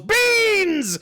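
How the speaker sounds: high, drawn-out mock war cry; battle yell. A narrator's reading. Game master voices Melran bursting into the kitchen, yelling. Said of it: "kicks in the kitchen door and yells".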